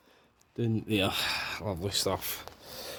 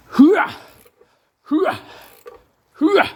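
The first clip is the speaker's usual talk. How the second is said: high, loud army hooah; loud gung-ho soldier; 6 dB louder, much slower than usual. Pretend. Shouted war cry.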